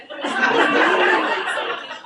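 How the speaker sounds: high loud delighted laugh; loud laughing burst. Real, not acted. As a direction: let out a big happy laugh.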